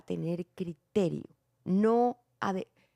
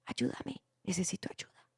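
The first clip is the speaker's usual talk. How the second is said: "Ayúdame, necesito ayuda" whispered, much softer, almost no voice; in a low voice; 8 dB quieter than usual. Shown as a demonstration. Director showing an actor's too quiet line, whispered. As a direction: breathe the plea out in a whisper. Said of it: "¿Tú por qué lo dices en voz baja?".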